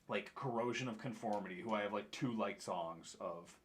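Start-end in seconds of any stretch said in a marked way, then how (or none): none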